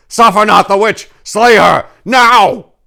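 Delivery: loud, high battle command; commanding shout. Acted. Paladin ordering the kill, shouting.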